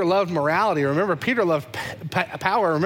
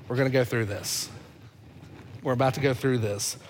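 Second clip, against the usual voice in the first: gentle Jesus voice; 4 semitones lower, 5 dB quieter than usual. Acted.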